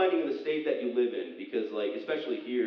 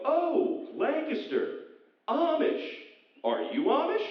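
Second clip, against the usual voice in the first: high, drawn-out nosy questioning; mock curious locals; 13 semitones higher, much slower than usual. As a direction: gush over Lancaster like a clueless local.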